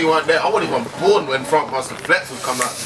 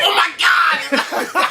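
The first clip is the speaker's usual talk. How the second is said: surprised shout; 10 semitones higher, much slower than usual. Real, not acted. Reacts to the other's voice.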